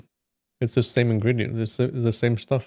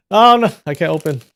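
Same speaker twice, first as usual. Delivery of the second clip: a bit higher and louder, fast, caught off guard; startled oh no; 5 semitones higher, 7 dB louder, faster than usual. Real, not acted. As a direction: blurt it out as the lid won't give. Struggling to open the package.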